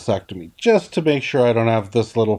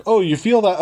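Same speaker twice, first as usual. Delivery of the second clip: lightly voiced doctor, pitched up in a question; higher mock-innocent check on the patient; doctor's query; 7 semitones higher than usual. Doctor's question retold after the gasp.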